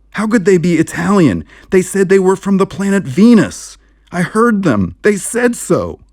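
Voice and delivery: high, loud frantic insisting; agitated protest. Acted. Narrator frantically arguing with the sheriff about Venus. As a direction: pile on the disbelief.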